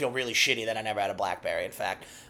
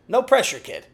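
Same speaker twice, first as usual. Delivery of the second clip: teasing; 8 semitones higher, 4 dB louder than usual. Acted. Teasing aside.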